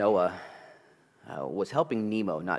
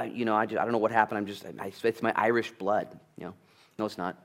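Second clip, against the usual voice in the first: ordinary talk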